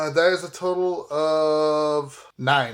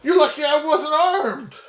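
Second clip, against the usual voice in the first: higher, heated comeback; in-character retort; 7 semitones higher than usual. Acted. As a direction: tell him off, higher and heated. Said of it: "I was like".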